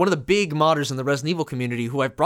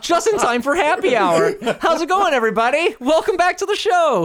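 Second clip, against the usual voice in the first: excited host; 10 semitones higher, 3 dB louder than usual. Real, not acted. Host greets after skit, laughing.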